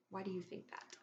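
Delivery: ordinary delivery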